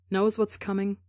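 ordinary delivery, nothing marked

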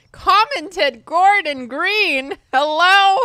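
reading his name in outrage; indignant disbelief. Real, not acted.

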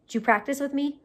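ordinary talk, nothing marked

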